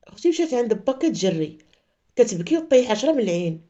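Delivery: ordinary talk, nothing marked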